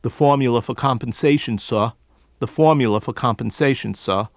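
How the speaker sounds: New York formula, for and sir without R's; New York accent, R's dropped. Shown as a demonstration. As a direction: drop every R, New York style: "suh". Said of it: "Instead of what he might normally do".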